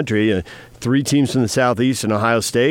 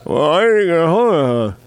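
gauze in mouth; 9 semitones higher than usual. Pretend. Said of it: "I got three pounds of gauze in my mouth".

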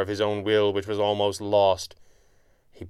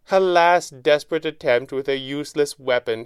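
high, raised voice full of furious glee; gleeful shout; 6 semitones higher, 4 dB louder than usual. A narrator's reading. Villain mocks hero's missed shot, shouting with furious glee. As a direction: shout in scornful triumph. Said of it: "he shouted".

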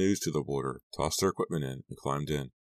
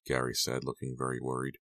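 ordinary delivery

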